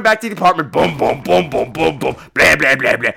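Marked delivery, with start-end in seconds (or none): 0.7-2.2 imitated heavy stomps, evenly spaced; mock storming-in noise; stomping footsteps. Imitates storming back home. 2.3-3.1 low, squawking grumble; imitated grouchy quacking; talking like the Penguin. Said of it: "talking like the penguin".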